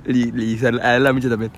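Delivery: ordinary delivery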